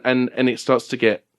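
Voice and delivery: normal talking voice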